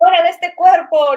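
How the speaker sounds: loud, high mock exorcist's cry; mock exorcism shout. Friend chases the mother's spirit out, mock shouting. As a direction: cast the mother out, hammed-up and loud. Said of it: "venía y me decía".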